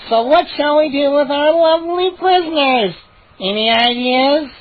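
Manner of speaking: high, theatrical gnome voice; gleeful gnome host. Acted. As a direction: rouse the crowd with glee. Chip addresses gnome crowd.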